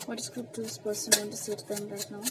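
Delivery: slow, soft, hushed asking of the cards; ritual question to spirit. Acted. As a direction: ask the spirit slowly and softly while shuffling.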